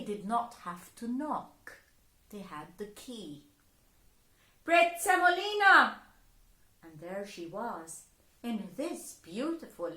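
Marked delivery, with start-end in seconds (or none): 4.6-6.0 loud, high call of her name; loud, high fairies calling the girl. Acted. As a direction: shout for her across the house.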